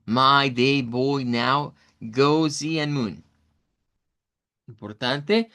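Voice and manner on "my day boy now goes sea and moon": slow, careful English, each vowel sound drawn out; slow English demo. Shown as a demonstration. Teacher reading the example words slowly for students. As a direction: read My day boy now goes sea and moon slowly. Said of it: "y el ejemplo es moon".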